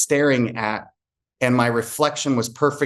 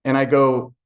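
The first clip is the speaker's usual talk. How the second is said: normal talking voice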